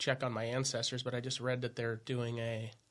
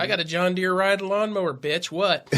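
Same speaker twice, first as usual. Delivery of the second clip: swaggering challenge, played for laughs; mock bragging; 5 semitones higher, 9 dB louder than usual. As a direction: boast it loudly, laughing into it. Mocking small-town man's brag.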